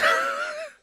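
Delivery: high squeaky burst of laughter. Real, not acted. Laugh at own sentence.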